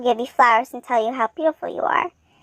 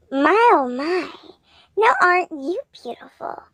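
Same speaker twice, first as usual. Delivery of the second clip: gushing admirer; 4 semitones higher than usual. A narrator's reading. Splendy greets the girl, gushing with admiration. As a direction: stretch it out in delighted admiration.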